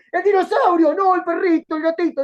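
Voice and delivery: loud, high, flustered correcting himself; distracted excited man. A man ignoring the dinosaur, loud and flustered. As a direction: blurt it out, all caught up in the pets. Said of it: "dice el tipo".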